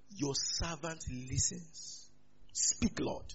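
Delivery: slow, hushed prayerful answer; reverent reply. Acted. Eli teaching Samuel to answer God, reverent reply. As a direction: offer it slowly, like a humble servant.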